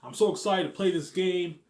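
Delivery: mock an eager gamer opening a video; imitating Robert, excited and loud; Robert impression. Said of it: "Not a bad impression, huh?".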